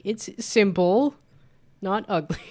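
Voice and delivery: high, slow, indignant protest; indignant retort. Retold or imitated. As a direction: drag it out, defending your work. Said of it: "I'm like".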